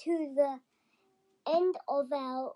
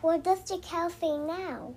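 put-on reading to a toddler. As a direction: lull a little one to sleep. Child guiding a sleep story.

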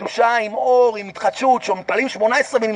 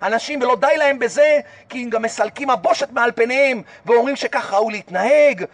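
ordinary talk

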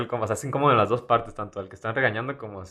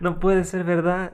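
higher, slow theatrical disbelief; dramatic disbelief; 9 semitones higher, slower than usual. Pretend. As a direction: play it like a soap opera. Mock disbelief at headline.

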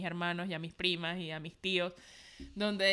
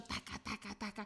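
quiet imitated heartbeat; soft heartbeat imitation; 2 semitones lower, 13 dB quieter than usual. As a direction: whisper the heartbeat sound. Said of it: "yo escucho". (imitación suave del latido)

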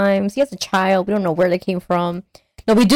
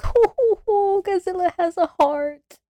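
mock swooning; 11 semitones higher than usual. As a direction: coo it, deeply touched. Moved by Godzilla.

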